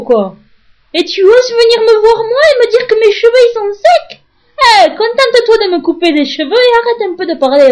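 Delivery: high, loud, near-crying rant; hammed-up tearful fury at the hairdresser; furious imagined tirade. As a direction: scream it at her, voice breaking.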